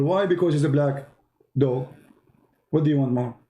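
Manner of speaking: normal talking voice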